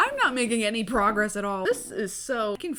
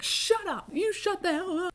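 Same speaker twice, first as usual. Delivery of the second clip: much higher mock-angry shout; angry uncle; 10 semitones higher than usual. Vernon yelling at Hagrid, a high mock fury. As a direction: snap it out like a furious uncle.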